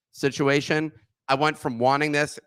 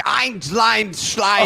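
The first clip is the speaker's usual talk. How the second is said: hammed-up Belgian accent, higher and louder; mock Belgian French; 8 semitones higher, 5 dB louder, much slower than usual. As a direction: fake Belgian French accent, laughing through it. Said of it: "it sounds like".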